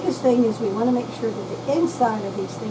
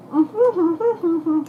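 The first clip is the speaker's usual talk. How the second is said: approving hums; 6 semitones higher, slower than usual. Real, not acted. Tasting fries, approving hums.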